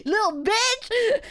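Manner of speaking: slow, drawn-out, high jeering yell; mock shout. Tipsy taunting the departing drunk man, slow jeering yell. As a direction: taunt him as he walks away. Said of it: "Me shouting in the background".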